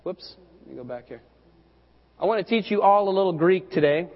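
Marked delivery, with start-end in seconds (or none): none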